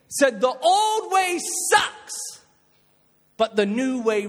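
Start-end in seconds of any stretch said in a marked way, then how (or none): 1.7-2.4 high, breathy punch on "sucks"; mock disgust. Preacher mocking the old way to youth. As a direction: spit out "sucks" for laughs.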